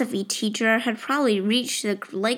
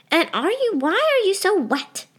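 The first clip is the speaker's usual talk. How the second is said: alarmed mother; 10 semitones higher than usual. Acted. Mother questions soaked daughter.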